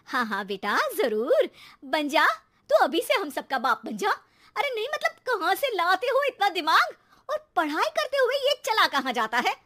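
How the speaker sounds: loud, mocking sarcasm; sarcastic scolding. Mother mocking her cheeky son, loud sarcasm. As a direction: sneer it at him.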